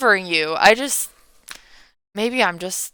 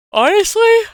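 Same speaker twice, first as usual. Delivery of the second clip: higher, yawned out word; yawning; 8 dB louder than usual. Real, not acted. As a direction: let a yawn pull it up. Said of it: "I'm yawning again".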